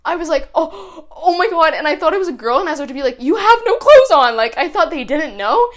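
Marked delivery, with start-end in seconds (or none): 0.5-1.7 acted shock. Acting out own shock in story. 3.2-4.4 high, loud retold exclamation; re-enacted shocked shout; acted startled outcry. Acting out startled warning in story.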